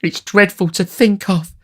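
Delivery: high, loud lady's dread; shuddering. A narrator's reading. Matron answers the beadle, shuddering high dread. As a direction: recoil from the thought in a high voice.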